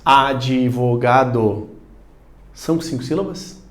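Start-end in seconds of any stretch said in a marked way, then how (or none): 0.0-1.7 "Advogado" very slow and low, in five syllables. Shown as a demonstration. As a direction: stretch "Advogado" as a-di-vo-ga-do. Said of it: "Você tem cinco pronúncias".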